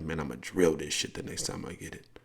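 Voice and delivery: quiet determined inner voice. Retold or imitated. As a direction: say it under your breath to yourself. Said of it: "In your head, you're like".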